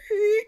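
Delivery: high squeaky creak noise; imitated stair creak.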